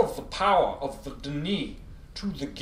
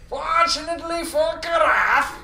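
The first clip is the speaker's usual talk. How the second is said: put-on very old man; 9 semitones higher, 4 dB louder than usual. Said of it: "how did I do the old man voice?".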